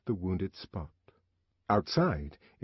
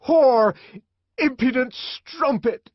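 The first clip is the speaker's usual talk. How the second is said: much higher, much louder raging shout; furious shout; 10 dB louder, slower than usual. A narrator's reading. The savage to Lenina as he shakes her, raging shout. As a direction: hurl the insult at full fury.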